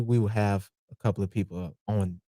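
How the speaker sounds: hushed secretive whisper. Acted. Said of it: "Why are you whispering?".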